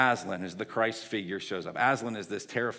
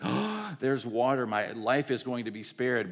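overjoyed; 2 semitones higher, 4 dB louder than usual. Acted. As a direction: gasp and act overjoyed at being saved. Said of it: "She's suddenly overjoyed".

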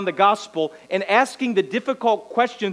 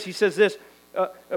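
ordinary talk

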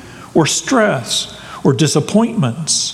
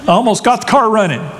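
quick, higher, hurried brush-off; impatient busy man; 6 semitones higher, faster than usual. Retold or imitated. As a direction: wave off the interruption, mid-task. Man interrupted while fixing car.

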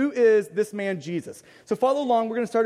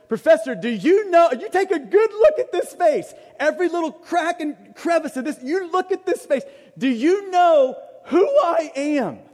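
louder, higher insistent student pleading; urgent louder student; 4 semitones higher, 5 dB louder than usual. Retold or imitated. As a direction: push hard, demand he look at you. Third time, building intensity.

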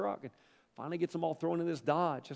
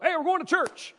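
dad's shout; 14 semitones higher, 9 dB louder than usual. Retold or imitated. Dad wakes family, announcing loudly.

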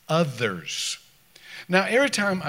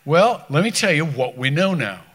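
normal talking voice

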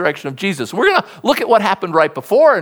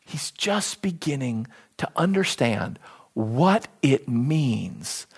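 slow, hushed near-whisper; 5 semitones lower, 7 dB quieter than usual. Real, not acted. Preacher hushing his church at the sermon's climax, whispered. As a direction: drop to a whisper to make them lean in.

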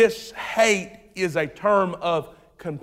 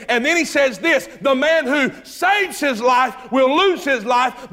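high, emphatic sermon delivery; preaching; 5 semitones higher than usual. Acted. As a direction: drive the verse home from the pulpit. Preaching the Matthew passage.

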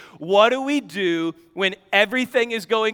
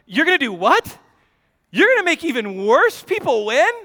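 high, loud disbelieving outcry; incredulous Habakkuk; 8 semitones higher, 4 dB louder than usual. Retold or imitated. Preacher voicing Habakkuk's shock at God, high loud disbelief. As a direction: voice Habakkuk's outrage at God, pitched up.

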